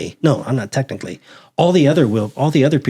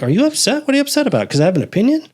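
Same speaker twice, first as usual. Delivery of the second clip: high, sing-song fake innocence; mock innocent concern; 11 semitones higher, much faster than usual. Needling the angry boss in a high innocent voice. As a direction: play dumb, voice way up. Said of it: "I'm like".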